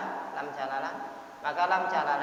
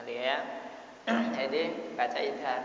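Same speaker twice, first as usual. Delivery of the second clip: ordinary talk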